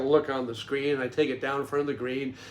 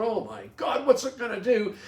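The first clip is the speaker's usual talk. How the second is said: retold panic; 8 semitones higher than usual. Panics as eagle nears drone.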